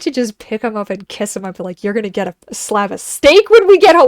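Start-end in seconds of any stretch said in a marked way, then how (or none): 1.8-4.1 higher, louder cooing promise; doting owner gushing to her pet; gushing to the dog. Retold or imitated. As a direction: spoil the dog with your voice.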